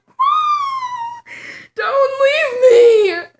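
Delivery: very high, drawn-out mock wail; desperate pleading. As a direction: beg theatrically, bawling down the phone. Begs mom on the phone.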